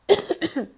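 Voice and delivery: one sharp loud cough; cough, then apologize; coughing fit. Real, not acted.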